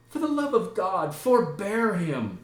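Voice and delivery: high, urgent motherly appeal; Gertrude pleading. Acted. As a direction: beg for mercy, pitched high. Gertrude begs patience for son.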